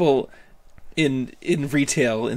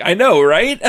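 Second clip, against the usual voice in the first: loud mock agreement; 4 semitones higher, 6 dB louder than usual. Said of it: "I was like".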